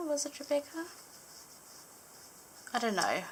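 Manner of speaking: usual speaking voice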